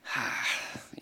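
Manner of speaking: long breathy sigh; discouraged sigh. Retold or imitated. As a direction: let out a soft, deflated sigh. Sighs over friends moving away unconverted.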